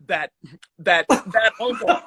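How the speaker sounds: slow, laughing mock dismay. Real, not acted. Guest laughs at being called the host's uncle, slow and chuckling. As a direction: drag it out, chuckling.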